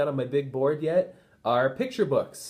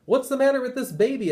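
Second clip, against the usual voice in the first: much higher, louder exclamation; raised exasperated question; baffled principal; 11 semitones higher, 5 dB louder than usual. Acted. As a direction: throw up your hands in disbelief. Principal baffled by screaming baby.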